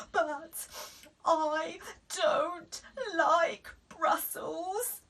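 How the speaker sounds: very slow tearful wail; mum crying. A narrator's reading. Mum crying over her Brussels. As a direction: wobble into a whine. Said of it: "said Mum".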